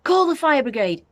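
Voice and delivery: high, loud sudden shout; panicked grandma shouting. A narrator's reading. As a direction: bellow for help. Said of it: "she shouted suddenly".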